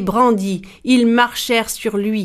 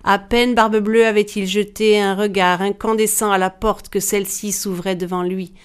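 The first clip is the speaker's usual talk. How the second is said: ordinary delivery, nothing marked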